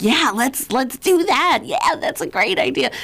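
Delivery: high-pitched, gung-ho agreement; mock over-eager yes; eager enthusiasm. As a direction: play yourself jumping at every idea. Retelling own eager reaction to ideas.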